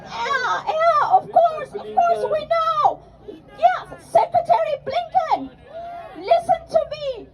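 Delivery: high, sarcastic mock agreement. Sarcastic imitation of officials.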